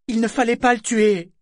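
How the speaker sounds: high, loud blurted protest; exclaiming. Acted. Yémin proteste. (exclamation brusque et aiguë)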